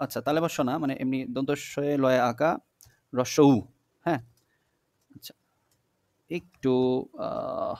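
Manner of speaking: normal talking voice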